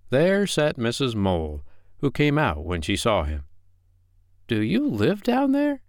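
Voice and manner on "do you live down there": high-pitched, polite question; polite little mouse. Acted. Willie asks Missus Mole at her hole, politely. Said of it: "asked Willie politely".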